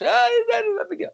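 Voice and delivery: very high-pitched mock wife voice; mocking wife imitation. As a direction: squeal it high, imitating her laughing it off. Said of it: "And she laughed".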